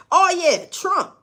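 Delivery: high, loud burst of remembering; sudden realisation. Real, not acted. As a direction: catch yourself remembering mid-thought. Sudden realisation mid-sentence.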